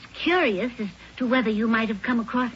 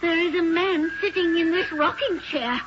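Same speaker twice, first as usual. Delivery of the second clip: high, frightened, mounting alarm; panicked report; 7 semitones higher than usual. Acted. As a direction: report it while frozen with fright. Reports invisible man holding her.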